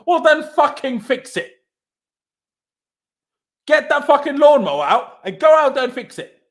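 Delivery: loud, angry shout; furious outburst. Real, not acted. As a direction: fix it, yell it out in real fury. Angry rant at the manager.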